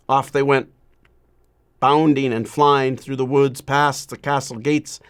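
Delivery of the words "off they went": usual speaking voice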